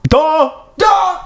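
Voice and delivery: very high, squealed DUH; mock dim-witted jeer. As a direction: squeak "Duh" in silly falsetto. Clowning repeat of the jeer.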